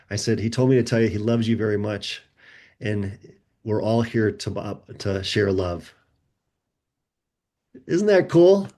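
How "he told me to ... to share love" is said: tender message. Retold or imitated. Relaying a dead son's love to his mother, tender.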